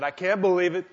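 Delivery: high, loud excited brother; quoting an agitated brother; high-pitched loud disbelief; brother's voicemail. Retold or imitated. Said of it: "He said".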